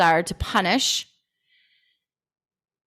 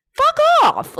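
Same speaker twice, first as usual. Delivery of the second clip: a sudden high yelled "Fuck off"; shouted fuck off; 4 dB louder than usual. Retold or imitated. Dismissing nosy people who pry, with a high mock yell. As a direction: blurt "Fuck off" loud and high, half laughing. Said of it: "And then it's like".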